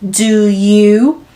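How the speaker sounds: very slow, every vowel drawn long; stretched out. Shown as a demonstration. Teacher drags the vowels out for students, very slow. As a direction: stretch each long vowel as far as it goes. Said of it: "소리가 계속 늘어나고 있죠".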